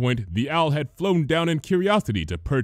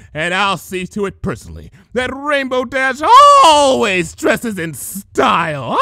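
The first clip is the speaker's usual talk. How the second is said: gloating villain; 11 semitones higher, 9 dB louder than usual. A narrator's reading. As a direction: gloat over the ponies like a triumphant villain. Gloating changeling villain speech.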